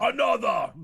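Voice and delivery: loud mock-heroic demand; Thor. Podcaster echoing the smashed-glass joke, booming Thor. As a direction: boom it out like a hero wanting another drink. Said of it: "Don't pull a Thor".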